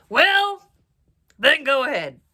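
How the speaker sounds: loud, grudging troll bellow. A narrator's reading. Troll letting the goat pass, loud bellow. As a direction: bark permission as the troll. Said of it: "said the troll".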